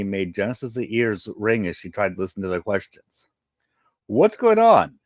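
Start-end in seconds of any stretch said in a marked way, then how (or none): 4.1-5.0 high, loud alarmed cry from the crowd; panicked shout. Acted. Frightened crowd member shouting at the agents.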